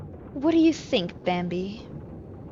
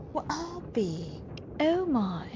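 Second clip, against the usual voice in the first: mock Southern accent; 7 semitones higher, 9 dB quieter, slower than usual. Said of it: "Country, right? Southern?".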